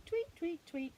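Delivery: high chirpy tweets; tweeting bird. Pretend. Reading to a child, bird tweets high. Bird noise in bedtime book.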